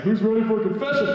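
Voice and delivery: high, loud-ish priest announcement; tipsy priest calling out. Retold or imitated. Priest walking into the kids' playroom, high eager call. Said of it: "he'd have a glass of gin".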